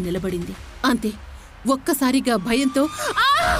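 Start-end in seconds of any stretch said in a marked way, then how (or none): 3.2-3.6 piercing high scream of sheer terror; terrified scream. A narrator's reading. Woman faints after seeing the ghost bride, terrified scream. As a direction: shriek loud enough to shake the house. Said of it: "haunted house మొత్తం దద్దరిల్లేలా మళ్లీ గట్టిగా అరిచి".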